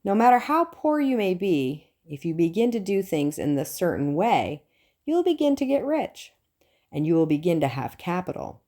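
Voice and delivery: ordinary talk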